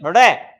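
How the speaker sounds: loud, low, isolated flap on ड़; flapped ड़. Shown as a demonstration. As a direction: flick the tongue forward for ड़. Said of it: "जिह्वा उठती है और बाहर की ओर".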